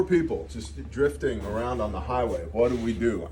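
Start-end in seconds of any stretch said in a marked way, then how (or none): none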